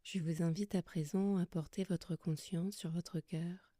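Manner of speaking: soft, calm yoga nidra guidance with long pauses; guided meditation. Acted. Yoga teacher settling listeners lying under blankets, soft guided meditation. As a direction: guide them gently into rest, leaving long silences between phrases.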